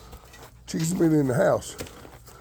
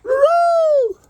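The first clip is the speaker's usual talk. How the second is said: high, loud sing-song "Roo-roo"; high chicken call; 7 dB louder than usual. Real, not acted. Calling the chickens in.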